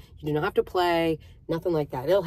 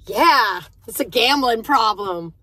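teasing cashier; 4 semitones higher, 7 dB louder, slower than usual. Retold or imitated.

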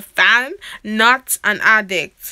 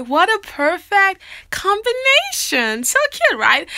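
gushing; 7 semitones higher, slower than usual. Real, not acted. Gushing over family photo.

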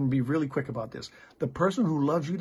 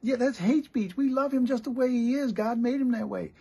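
high-pitched, louder imitation of protesting friends; mock indignant friends; 10 semitones higher, 4 dB louder than usual. Three friends jumping on the pastor to defend him, mock indignant. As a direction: put on the voices of outraged friends, pitched way up. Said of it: "they jumped on me".

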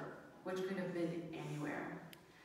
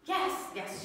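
imitated impatient teacher; 9 semitones higher, 5 dB louder than usual. Teacher answers the pupil's request.

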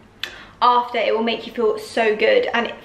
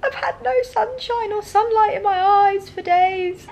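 high whingey moan to Jordan; mock moaning; 11 semitones higher than usual. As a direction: whine it like a sulky moan. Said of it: "moaning at Jordan saying".

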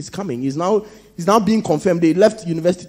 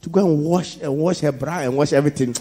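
usual speaking voice

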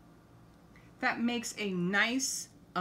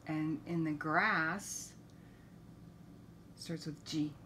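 plain reading voice

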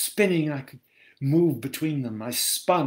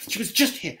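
anxious insistence; 7 semitones higher, 4 dB louder, faster than usual. Acted. Woman insists child was just there.

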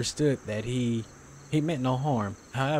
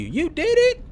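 high, loud stunned question; can't believe what just happened; shocked disbelief; 7 dB louder than usual. Real, not acted. Shocked reaction to anime twist.

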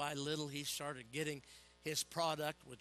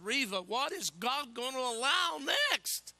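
much higher, louder and slower, venting at the wife; frustrated outburst; 10 semitones higher, 10 dB louder, slower than usual. Retold or imitated. Preacher to his wife in the basement, frustrated loud outburst. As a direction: cry out in exasperation, dragging it out. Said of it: "That's how frustrated I was".

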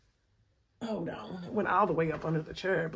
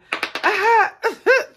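high laugh; 13 semitones higher, 8 dB louder than usual. Real, not acted. Laughing at own mistake.

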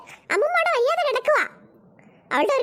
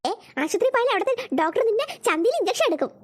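ordinary talk, nothing marked

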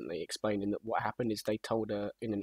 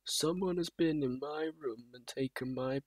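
slow, drawn-out imitation of a bleary complaint; slow put-on rock star grumble; 4 dB quieter, slower than usual. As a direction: drag it out, fading towards the end. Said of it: "the clip where [name]".

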